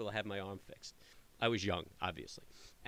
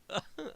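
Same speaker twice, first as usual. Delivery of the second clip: laugh; 13 semitones higher than usual. Real, not acted. Laughs at caller's answer.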